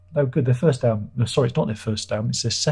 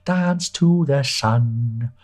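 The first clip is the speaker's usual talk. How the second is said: soaring high, very slow drawn-out crooning; high slow mock crooner; mock singer; 8 semitones higher, 3 dB louder, much slower than usual. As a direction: send up a pompous singer, stretching every word. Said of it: "twenty albums of him going".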